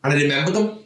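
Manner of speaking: ordinary talk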